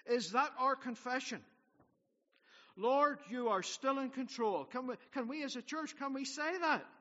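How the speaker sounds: loud, rising sermon declaration; rally the church with conviction; preaching at full force. Acted. Pastor rallying his church that God is in control, preaching at full force.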